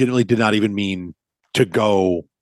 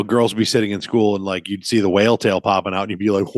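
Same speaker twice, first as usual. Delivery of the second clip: usual speaking voice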